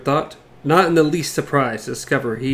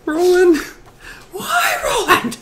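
high, drawn-out mock wail; hammed-up despair; slower than usual. A reader begging the gunslinger not to betray Jake, mock wail. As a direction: plead with him in overblown grief.

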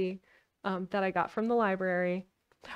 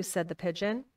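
usual speaking voice

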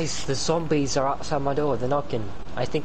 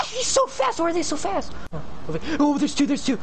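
panicked; 13 semitones higher than usual. Real, not acted. Panicking at fast zombies.

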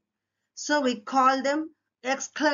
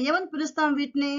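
normal talking voice

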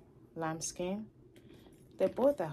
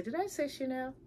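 self-surprise; 7 semitones higher than usual. Retold or imitated.

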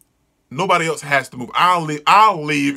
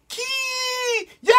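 long, very high thin shriek; drawn-out high-pitched cry; high acted scream; 3 dB quieter than usual. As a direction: squeal it out, high and held.

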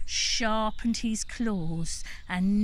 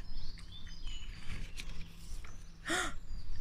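dramatic gasp; 8 semitones higher, 7 dB quieter than usual. Acted. Storyteller gasps.

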